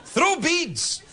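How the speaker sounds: high shouted parody; mock cheer. Host imitating a party video, high mock shout. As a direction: yell it like a party crowd. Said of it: "so the videos are kind of".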